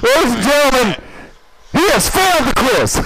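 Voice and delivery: booming quiz host verdict; announcer voice. Acted. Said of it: "It's my announcer voice".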